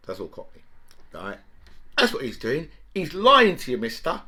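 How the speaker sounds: ordinary talk, nothing marked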